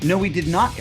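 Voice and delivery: ordinary talk, nothing marked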